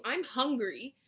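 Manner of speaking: usual speaking voice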